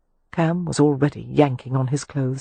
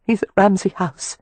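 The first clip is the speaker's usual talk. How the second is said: chattering teeth; 5 semitones higher, 3 dB louder than usual. A narrator's reading. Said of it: "she said through chattering teeth".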